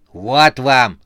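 loud exclamation; brusque handover. A narrator's reading. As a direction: toss it at them with force. Boss throws parcel at subordinates.

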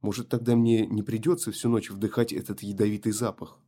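ordinary delivery

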